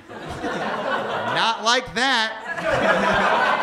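drawn-out slow correction; girlfriend correcting. Retold or imitated. Girlfriend slowly correcting him in bed. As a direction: stretch it out like a patient teacher. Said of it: "This one girl was just like".

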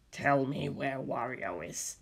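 low, slow, quiet threat; a slow soft menace from the creature; menacing demand. Acted. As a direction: monster demanding Wario's whereabouts, low and slow. Monster demands answer.

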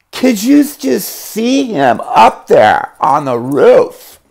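lightly voiced high, slow amazed friend; a hint of a stunned onlooker. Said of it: "one of them goes like that".

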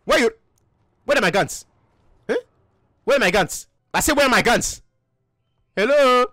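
demanding, put-on African accent. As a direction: press for answers in a mock African accent. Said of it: "so you don't have an African accent".

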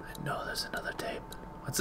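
hushed whisper to himself; breathy under-the-breath murmur. Real, not acted. Whispering while searching the room.

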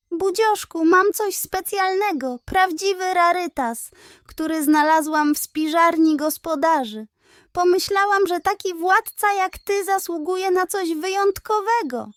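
butter up the vain pug like a cunning little pig; sweet-talking piglet. A narrator's reading. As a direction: piglet Pusia coaxing the emperor with a treat.

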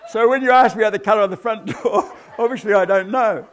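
cracking up while telling it; laughing. Real, not acted. Laughing as he tells the audience the punchline.

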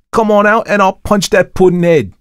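high, loud Cockney dare; shouted Cockney threat. A narrator's reading. Thomas dares the watchman to fight, shouting in Cockney. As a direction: yell it as a fighting challenge in Cockney.

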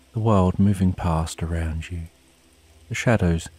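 usual speaking voice

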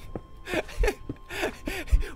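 high breathy giggling fit; helpless giggle. Real, not acted. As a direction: crack up at the slip. Laughing at own slip.

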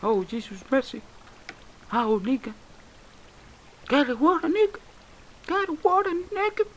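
mock-panicked cries instead of cursing; mimicked catchphrase. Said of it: "en vez de decir Dios y cabrearme".

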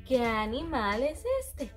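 usual speaking voice

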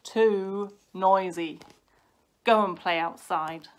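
loud, firm order; cross dad. A narrator's reading. Dad disturbed by noisy sons, loud and cross. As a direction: send noisy cubs out firmly.

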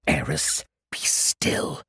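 whispered, urgent hush. Acted. Paolo hushing Eris near the beasts, whispered. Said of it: "Paolo spoke in a hushed voice".

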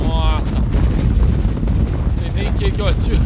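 loud, thrilled shouting at the herd; excited. Real, not acted. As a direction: yell with real hunting excitement. Stampede of buffalo, hunting excitement.

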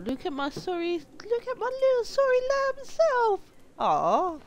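high squeaky little lamb; cute lamb voice. Pretend. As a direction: coo over your tiny lamb self. Voicing his cute lamb character.

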